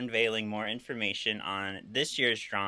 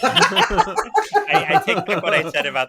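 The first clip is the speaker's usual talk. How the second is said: blurted fast through laughter; laughing; 8 semitones higher, 9 dB louder than usual. Real, not acted. Host taking back his praise while laughing. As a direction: tease her while cracking up.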